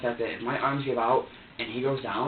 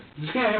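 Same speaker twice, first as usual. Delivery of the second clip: short laugh; 9 semitones higher, 5 dB louder than usual. Real, not acted. Laughs at own story.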